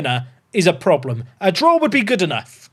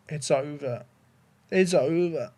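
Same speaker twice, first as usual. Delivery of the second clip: soft, resigned murmur; defeated; 4 semitones lower, 9 dB quieter than usual. Real, not acted. Streamer concedes the match is lost, quiet defeat. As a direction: say it quietly, giving up.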